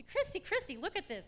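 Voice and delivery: high, loud, eager retold call; excited calling. Coming back to show Christy the brochure, excited. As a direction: grab a friend's attention, all excited.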